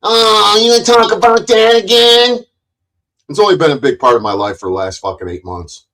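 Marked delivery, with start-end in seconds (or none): 0.0-2.4 high, loud whiny mock voice; high, loud mock of the chat hecklers; mocking people. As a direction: mimic the hecklers in a squeaky whine. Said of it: "tired of people fucking".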